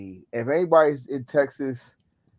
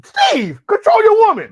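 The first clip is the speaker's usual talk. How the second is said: high, loud cry at Steve; mock yelling at the husband in a high voice; exasperated yell; 11 dB louder than usual. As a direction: shout it over the noise, fed up. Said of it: "you gotta put the hiss in there".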